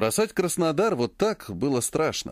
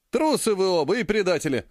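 half-hearted indignation; 5 semitones higher than usual. A narrator's reading. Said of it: "возмутился Ержан, но без огонька".

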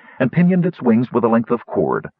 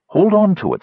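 eager command; 11 semitones higher, 4 dB louder than usual. A narrator's reading. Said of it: "said Kitiara".

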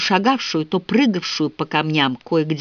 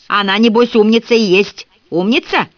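louder, higher sarcastic jab; sneering; 6 semitones higher, 5 dB louder than usual. A narrator's reading. Old man taunts Milly about Winnie, sneering. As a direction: throw the word back at her, raised and sarcastic. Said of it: "съязвил старик".

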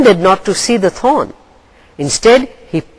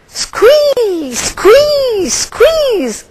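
very high bird screech, repeated; screaming; much slower than usual. A narrator's reading. Gull screaming in pain as Raven pushes the thorn. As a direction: shriek in agony three times. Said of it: "screamed Gull".

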